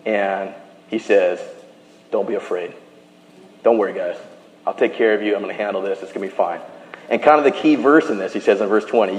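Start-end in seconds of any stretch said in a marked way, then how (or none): 2.1-6.6 low, soft, soothing voice; calm reassurance. Acted. Joseph reassuring the brothers who wronged him, calm reassurance. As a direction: quietly put them at ease, low and easy.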